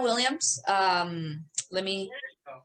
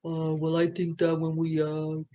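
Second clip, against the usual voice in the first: deep voice imitation; 6 semitones lower than usual. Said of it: "fucking deep voice".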